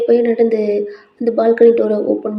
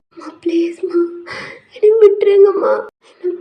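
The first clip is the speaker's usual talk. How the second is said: high, tearful begging; acted sick girl sobbing to be left alone; crying; 9 semitones higher than usual. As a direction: plead through tears in a thin, raised pitch. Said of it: "எதுக்கு இப்படி அழுகுற".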